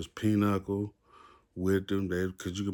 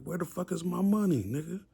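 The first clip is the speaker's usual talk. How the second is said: retold angry yell; 11 semitones higher than usual. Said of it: "he yelled at his partner".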